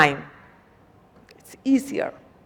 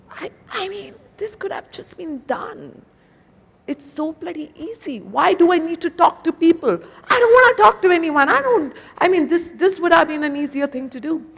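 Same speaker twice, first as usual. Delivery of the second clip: put-on higher, fed-up young man ranting; frustrated nephew voice, pitched up; exasperated nephew; 7 semitones higher than usual. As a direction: mimic the nephew whining that calling was pointless. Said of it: "He's like".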